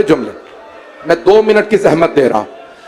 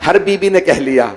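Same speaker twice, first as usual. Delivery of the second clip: ordinary talk, nothing marked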